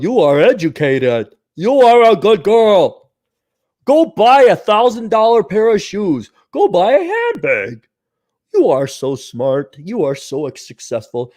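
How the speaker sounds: slow, sugary mock praise; mocking flattering voice. Mocking how society flatters women, slow and sugary. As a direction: coo it like a patronizing cheerleader.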